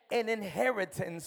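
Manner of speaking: ordinary talk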